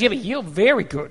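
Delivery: God savoring his favorite creation; tender God voice. Retold or imitated. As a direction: lean in close and murmur it fondly. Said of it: "he looks at you and goes".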